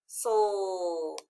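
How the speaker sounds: "soul" drawn out, falling; long "soul" sinking in pitch; isolated final syllable. Shown as a demonstration. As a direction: stretch "soul" as one lone syllable. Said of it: "the final stable syllable".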